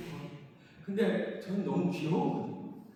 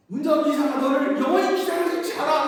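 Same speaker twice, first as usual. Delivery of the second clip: higher, louder angry mother's yell; mother yelling at son; 9 semitones higher, 13 dB louder than usual. Retold or imitated. Mother yelling at her dawdling son, loud angry scolding. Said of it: "막 뭐라고 소리를 또 막 질러요".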